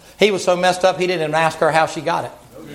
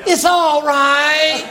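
put-on higher sing-song baby talk for a grown man; doting mama voice; 10 semitones higher than usual. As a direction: coo over him like a sulking toddler. Jezebel soothes Ahab again.

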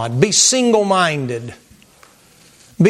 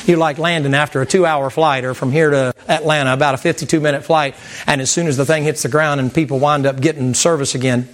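ordinary talk, nothing marked

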